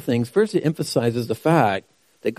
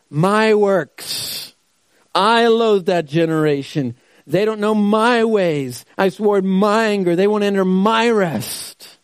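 louder, raised voice of an angry God; a hint of divine wrath, loud and firm; God speaking; 5 semitones higher, 4 dB louder than usual. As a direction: proclaim it as God in his anger. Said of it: "God is speaking directly now".

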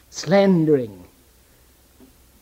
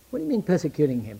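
usual speaking voice

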